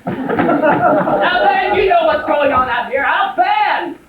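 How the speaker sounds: high, loud call across rooms; exasperated yelling. Acted. Husband calls Alvin out to the show, high exasperated yell. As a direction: yell for your partner urgently.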